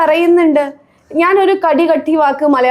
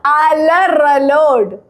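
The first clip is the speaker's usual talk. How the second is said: very slow, stretched syllables; slow demonstration; much slower than usual. Shown as a demonstration. Coaching a friend on one hard word, very slowly. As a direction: draw the word right out.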